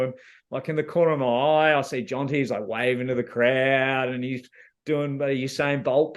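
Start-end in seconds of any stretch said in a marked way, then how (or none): none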